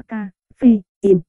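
"ca, phê, in" as three separate syllables; syllable-by-syllable reading. Shown as a demonstration. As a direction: sound out "ca, phê, in" with pauses. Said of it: "caffeine đọc là". (đọc "ca, phê, in" tách từng âm tiết)

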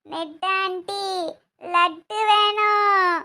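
put-on very slow, drawn-out kid begging; five-year-old child. Child asking auntie for a laddu, childlike. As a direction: stretch the plea like a small child. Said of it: "அஞ்சு வயசு குழந்தைதான்".

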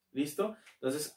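ordinary delivery

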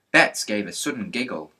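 ordinary delivery

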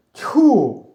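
high, very loud spat exclamation; disgusted "pah". A narrator's reading. Boy bumping into his friend's trousers, disgusted exclamation. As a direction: spit it out in vexation.

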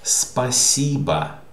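спасибо slow, "spa-SI-ba", middle syllable lifted; careful спасибо with the second syllable stressed. Shown as a demonstration. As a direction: draw out the SI in спасибо. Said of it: "This is stressed".

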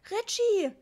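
put-on high, loud call for a child; shrieking mum; mother's shout. A narrator's reading. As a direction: cry out the name in alarm. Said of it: "schrie Mama".

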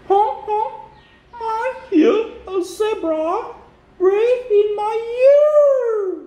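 very high, drawn-out flamingo voice; storybook flamingo. Acted. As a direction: read to children as a honking flamingo. Reads flamingo's lines to children.

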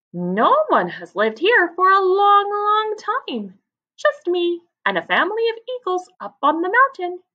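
high, loud cheerful lizard voice; a chatty little lizard greeting newcomers; friendly lizard man. Acted. Agama man speaks to dassies.

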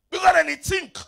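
loud high shout; preaching shout. Real, not acted. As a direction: shout it to the crowd. Preaching climax.

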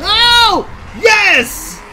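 high, loud whooping cheer; triumphant shout. Real, not acted. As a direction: yell out in triumph. Triumphant game moment.

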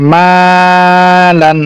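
very slow, level, sustained first vowel in "মালান"; stretched madd. Shown as a demonstration. Teacher showing students madd, "মা" drawn out, "লান" short. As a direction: hold "মা" long in "মালান", then a quick "লান". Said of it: "মাকে টানলাম".

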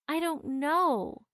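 slow, helpless, a touch teary; girl near tears holding her little brother. Acted. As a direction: drag it out, close to crying. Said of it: "Susan exclaimed, nearing tears herself".

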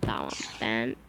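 hushed whispered aside; whispered aside to himself. Real, not acted. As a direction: drop to a breathy whisper. Quiet aside while playing.

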